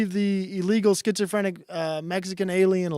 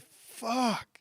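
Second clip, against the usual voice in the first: retold curse; 6 semitones higher, 6 dB quieter than usual. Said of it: "And I said".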